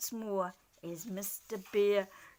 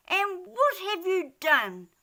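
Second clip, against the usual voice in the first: high, loud angry yell; 9 semitones higher, 6 dB louder than usual. Acted. Dormouse yelling at the squirrels, angry. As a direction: snap it at them hard. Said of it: "he yelled at the squirrels".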